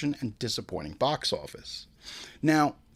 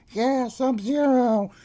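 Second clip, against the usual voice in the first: higher, slow, drawn-out echoing cheer; distant fan cheer; 11 semitones higher, 3 dB louder, much slower than usual. Retold or imitated. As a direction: imitate a far-off guy calling back, stretched. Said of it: "some other guy down front was like".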